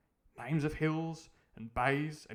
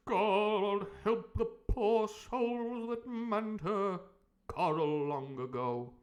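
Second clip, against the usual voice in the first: character read-aloud voice; 8 semitones higher, 3 dB louder than usual. Acted. Squire reading the captain's book to the doctor, higher and grave. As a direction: let the horror creep in on the poor souls.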